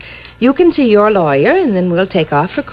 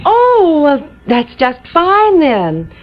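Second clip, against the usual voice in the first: delighted; 8 semitones higher, much slower than usual. Acted. Jean delighted squeal.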